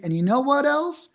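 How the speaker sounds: ordinary talk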